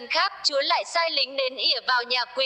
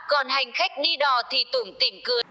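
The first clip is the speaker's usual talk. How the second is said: ordinary delivery, nothing marked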